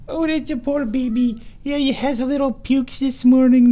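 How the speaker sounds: high, cooing sympathy. Real, not acted. Owner pitying her sick cat in high baby talk. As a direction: fuss over the poor kitty. Comforting the cat after vomiting.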